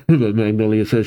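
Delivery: usual speaking voice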